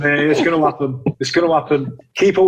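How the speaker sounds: said through a laugh. Real, not acted. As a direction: laugh while promising your mate. Laughing reply to friend's joke.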